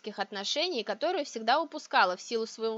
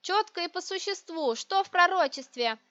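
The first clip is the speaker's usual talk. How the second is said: higher, brisk demand; irritated; 8 semitones higher, 4 dB louder than usual. A narrator's reading. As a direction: demand a straight answer, out of patience. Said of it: "раздражённо отрезала я".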